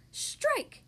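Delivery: high, quick exclamation; high strike call. A narrator's reading. Grandma bowls the ball, high call of strike.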